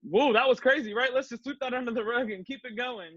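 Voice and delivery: mock the editors as if nothing happened; imitating a flippant editor waving off the scandal; breezy editor brushing it off. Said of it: "and being like".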